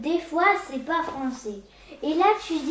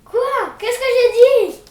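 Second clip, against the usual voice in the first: startled; 8 semitones higher, 14 dB louder, faster than usual. Acted. Boy startled after sister's outburst.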